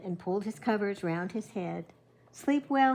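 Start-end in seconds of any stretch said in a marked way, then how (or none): none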